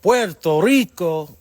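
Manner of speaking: drawn-out, high, loud call; hollered roll-call greeting, stretched out; loud shout-out. Real, not acted. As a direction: sing out the place name loud and long. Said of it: "Let me not talk too loud out here".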